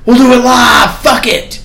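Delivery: high, loud shouting rant; Bill O'Reilly freak out. Retold or imitated. Anchor freaking out when the teleprompter fails, shouted high. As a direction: bark it out like an anchor losing it on air. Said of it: "the Bill O'Reilly freak out".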